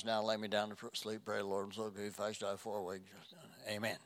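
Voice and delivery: low, soft, hurried mumbled recital; rote prayer. Acted. Child's bedtime prayer said out of habit, mock rote. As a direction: rattle off a bedtime prayer without feeling.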